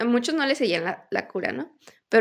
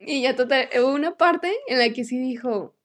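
ordinary talk, nothing marked

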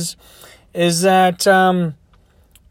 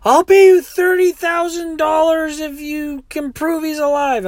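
sobbing; 10 semitones higher than usual. Retold or imitated. Sobbing woman offers driver money for proof, hammed-up wailing. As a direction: plead in an exaggerated high whine. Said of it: "she's sobbing and sobbing".

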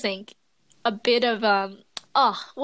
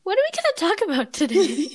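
higher-pitched, laughing group quote; mock clueless podcasters; 7 semitones higher than usual. Teasing their own procrastination on the podcast, mock clueless. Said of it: "do you know what we do?".

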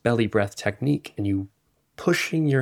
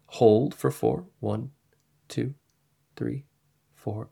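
slow breath counting; slower than usual. Shown as a demonstration. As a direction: count the hold gently. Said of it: "I invite you to try right now".

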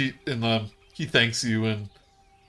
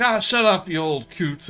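dismissive character retort; 7 semitones higher, 4 dB louder than usual. Acted. Bullywug snaps back at the head.

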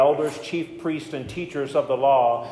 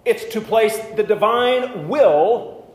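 fervent preaching; 6 semitones higher, 6 dB louder than usual. Real, not acted. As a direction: drive the point home to the congregation. Preaching at full force.

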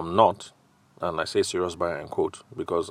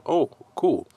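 pitched up, a quick pleased "oh, cool"; bright surprise; 8 semitones higher, 5 dB louder than usual. Pretend. Salesman hearing the uncle will pay, pleased and upbeat. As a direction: sound delighted to catch the opening. Salesman reacting to client's news.

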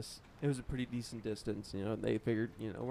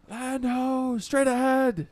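high, loud lookout call; bellowing over the wind; 14 semitones higher, 11 dB louder, slower than usual. Acted. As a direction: call it out from the crow's nest. Lookout calls land again.

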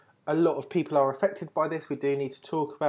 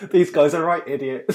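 louder, laughing through the words; a hammed-up insult lost in laughter; laughing mock verdict; 8 dB louder than usual. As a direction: blurt it out while cracking up. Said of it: "You're like".

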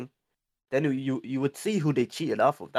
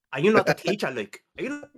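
rising, loud furious parent rant; angry parent; 10 semitones higher, 5 dB louder than usual. Pretend. Parent furious at teacher at a meeting, shouting higher and higher. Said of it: "being like".

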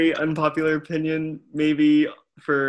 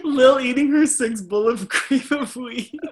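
laughing; 7 semitones higher than usual. Real, not acted. Laughing teasing.

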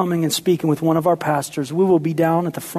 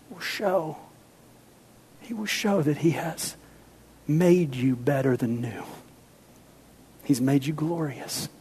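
hushed; 8 dB quieter than usual. Real, not acted. Hushed climax of sermon.

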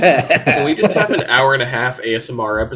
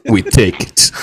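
put-on Russian accent; 7 dB louder than usual. Russian bit continues.

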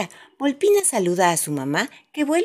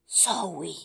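wind whisper; 8 dB quieter than usual. A narrator's reading. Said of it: "volvió a soplar el viento".

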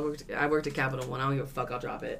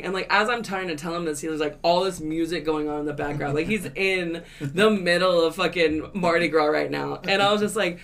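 usual speaking voice

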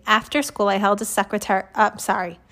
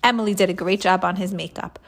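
ordinary delivery, nothing marked

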